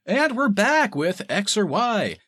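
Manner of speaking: slow, high announcer voice of a host back from break; talk show host. Retold or imitated. Podcaster mimicking a talk show host, slow high announcer voice. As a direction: play the cheesy host coming back on air.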